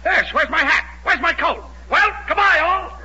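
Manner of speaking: a chirpy patient grabbing his hat and coat; sudden chipper recovery. Acted. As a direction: bounce up fully revived and bustle out. Revived patient leaps up leaving.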